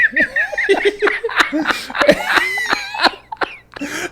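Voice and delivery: real laughter breaking out high; burst of laughter. Real, not acted. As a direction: crack up laughing at your own story. Laughing at the retold story.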